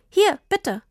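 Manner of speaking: high, beaming offer. A narrator's reading. Daughter hands papa his present, beaming. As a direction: offer it brightly, pitched well up. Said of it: "sagte Tini strahlend".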